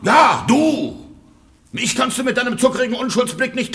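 shrill jealous husband scolding the servant; angry scolding. Acted. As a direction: tear into her with rage. Husband accusing the maid.

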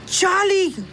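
high startled call of a little dog; a small dog's high worried cry; alarmed puppy call. Acted. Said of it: "roept hij".

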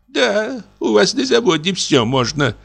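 high, mocking jab at a sinner; a touch of malicious pleasure; gloating spite. A narrator's reading. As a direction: sneer it with spiteful glee. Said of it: "сердито стукнув злорадной улыбкой".

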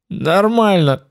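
wet gurgling voice. A narrator's reading. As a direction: say it through a gurgle in the throat. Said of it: "булькающим голосом откликнулся я".

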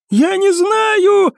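high, drawn-out desperate yell; panicked cry. A narrator's reading. Cadet answers the doubting rangers, crying out. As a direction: cry it out helplessly. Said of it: "крикнул в ответ курсант".

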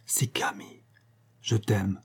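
faint, near-whispered last words; dying breath. A narrator's reading. Dying Sanda to Lucien at her bedside: dying breath. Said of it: "dans un dernier souffle".

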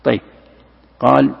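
usual speaking voice

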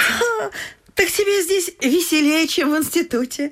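high, teasing laugh of surprise; mock her gently with a high laugh; high amused surprise. Mother teasing Nadenka about the country, high and amused.

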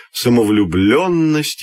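plain reading voice

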